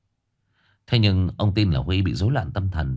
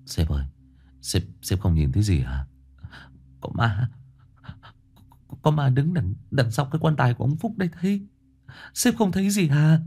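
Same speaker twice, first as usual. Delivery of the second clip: terrified pleading; 5 semitones higher than usual. A narrator's reading. Huy pleading with his boss, rising terror. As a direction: beg him to see it.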